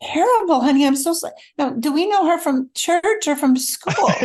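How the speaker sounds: high-pitched, earnest mock concern; worried sympathy. Husband consoling his crying wife, worried. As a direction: imitate a husband's anxious, careful condolence. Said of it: "extremely worried look".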